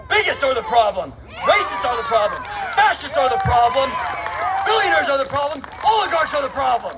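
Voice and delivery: building shouted list; rally shout. Real, not acted. Chanting list to the crowd.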